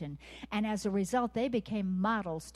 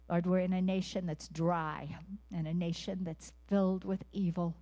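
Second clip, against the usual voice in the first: normal talking voice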